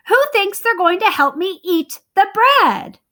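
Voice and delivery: little red hen furious at being ignored, high and loud; indignant hen. Acted. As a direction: pile up the grievances. Hen challenges.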